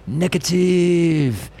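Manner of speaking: high, long drawn-out singsong delivery; big smile in the voice; cheerful announcement. Retold or imitated. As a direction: imitate a gleeful doctor, stretching the word right out. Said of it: "with a big smile".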